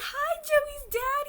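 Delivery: very high, loud, drawn-out mock wail; imitated tearful squeal. Crying fan greets the idol's dad, high tearful squeal. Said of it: "Why are you crying so hard".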